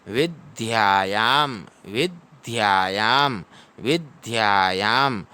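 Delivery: slow "विद्यायाम" as vid-dyaa-yaam; syllable-by-syllable demonstration. Shown as a demonstration. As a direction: stretch both long vowels in "विद्यायाम". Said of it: "द और य मिल के ध्य".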